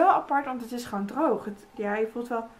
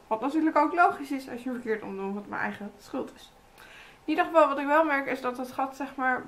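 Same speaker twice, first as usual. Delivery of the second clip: talking through a sheet mask. Real, not acted. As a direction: mouth opening restricted by mask. Seen 1: Sheet mask worn over whole face; mouth hole small, speaks through it.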